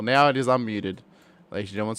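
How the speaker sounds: ordinary talk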